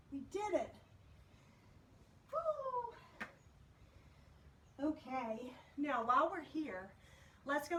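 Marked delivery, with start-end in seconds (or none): 2.3-3.0 high cheer after a hard set; excited whoop. Real, not acted. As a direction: whoop with tired triumph. Celebrating finishing the planks.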